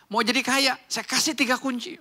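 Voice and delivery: high, loud cocky pitch; punchy, swaggering imitation; imitating brash youth. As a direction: mimic a blunt young money guru. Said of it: "kasar lah bahasanya".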